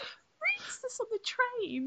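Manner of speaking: very high, small, slow voice; incredulous squeak. Retold or imitated. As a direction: squeak it softly in mock disbelief. Mock disbelief at train readers.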